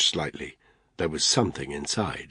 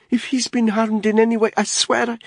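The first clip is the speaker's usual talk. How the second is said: high, loud threatening woman's voice; angry mother; 6 dB louder than usual. Acted. Mother threatening the man blocking her door, high and loud. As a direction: threaten him, voice climbing high.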